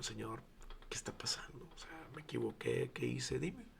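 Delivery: whispered, pleading prayer to God; tearful whispered prayer. Retold or imitated. Pastor retells crying to God when nobody came, whispered. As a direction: hush it like a desperate prayer. Praying in tears, desperate.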